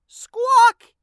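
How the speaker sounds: very high, loud parrot squawk. A narrator's reading. Parrot Igor squawks at the librarian, loudly. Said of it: "Igor squawked".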